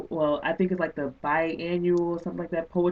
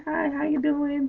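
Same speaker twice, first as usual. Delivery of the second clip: higher, slow mock polite hello; lightly voiced, higher polite hello; polite greeting; 9 semitones higher, slower than usual. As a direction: play the quick courteous fan greeting.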